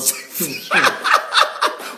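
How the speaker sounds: airy unvoiced chuckle mid-sermon; breathy laugh. Real, not acted. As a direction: laugh at your own jab. Laughs at own point.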